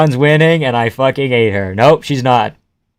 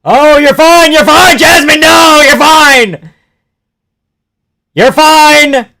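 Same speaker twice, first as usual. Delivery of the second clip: much higher, loud, desperate shouts of encouragement; frantic yelling; 14 semitones higher, 9 dB louder, slower than usual. Real, not acted. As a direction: scream at the fighter to keep going. Yelling encouragement at fighter Jasmine.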